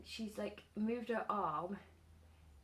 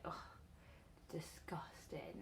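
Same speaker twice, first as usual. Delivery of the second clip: slow, whispered and much softer disgust; 4 semitones lower, 8 dB quieter, slower than usual. Retold or imitated. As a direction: whisper it slowly, grossed out. Cat brought in a bird.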